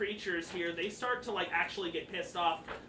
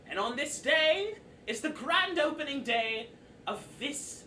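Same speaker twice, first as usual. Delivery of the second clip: Mister Light addressing troupe; 5 semitones higher than usual. Acted. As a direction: announce it grandly to the performers. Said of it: "he looks at all of you and he says".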